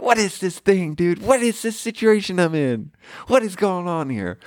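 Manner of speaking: high exasperated whine, laughing at the absurdity; high panicked whine. Retold or imitated. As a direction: wail it like you're losing your mind. Retold panic, same voice.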